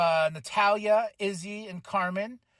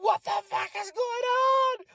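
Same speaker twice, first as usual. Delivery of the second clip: shocked scream. Retold or imitated. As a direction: scream in disbelief. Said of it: "I was like".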